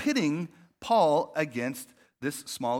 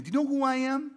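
higher, strained self-important challenge; mock offended big shot; indignant do you know who I am; 9 semitones higher than usual. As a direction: poke a finger in their chest as you ask. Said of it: "like a finger in a chest".

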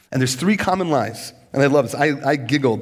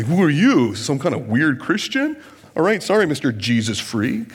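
mocking coworker. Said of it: "they're gonna say".

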